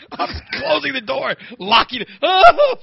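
lightly squealed, high panicky retelling; a touch of hammed-up horror; high, flustered play-by-play of escape; mock panic. Acts out panicked retreat.

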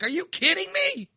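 high, disbelieving "are you kidding me"; exasperated disbelief. Retold or imitated. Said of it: "I'm like".

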